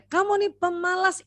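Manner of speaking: high scolding quote, stress on "Kamu"; mock scolding. Shown as a demonstration. Parent labelling the child lazy, high mock scold. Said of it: "Kita bilang sama dia".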